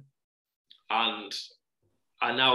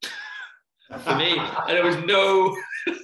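laughing; 6 semitones higher, 5 dB louder, much slower than usual. Real, not acted. Laughing at the absurd story.

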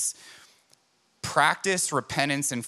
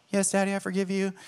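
put-on little girl's voice; 8 semitones higher, faster than usual. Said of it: "daughter voiced forgiving father".

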